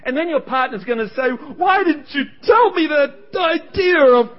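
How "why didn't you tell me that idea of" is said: high, slow mock shout; outraged partner. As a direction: shout it in exaggerated reproach at your partner. Partner's reproach acted out.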